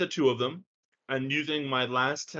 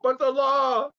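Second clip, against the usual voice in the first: high, loud comic cry; mock protest; 10 semitones higher, 6 dB louder, slower than usual. Player jokingly protests in character.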